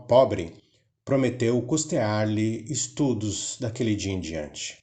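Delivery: ordinary delivery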